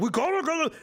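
high-pitched frantic gabble; mock frantic official. Officials fretting over empty clinics, mock panic.

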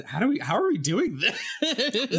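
high, loud laughing question. Real, not acted. Cohost laughs at how hard writing sounds. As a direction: laugh the question out in mock despair.

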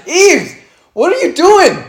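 real frustrated yell; exasperated outburst. Real, not acted. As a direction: shout at the ref through the screen. Yelling at the ref on screen.

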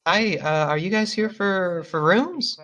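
high, startled woman's voice; high surprised hello from a sweeping woman; flustered innkeeper woman. Acted.

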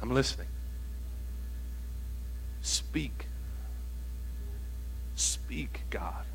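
very soft, low near-whisper; barely voiced, waiting on God; hushed listening prayer. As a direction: whisper an invitation, with long silences between. Acts quietly waiting on God.

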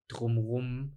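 ordinary delivery

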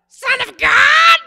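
high, slow, very loud shriek; piercing demonic shriek at Jesus; shrieking demoniac. Retold or imitated. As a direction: scream it like a possessed man. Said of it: "Like he shrieked at him".

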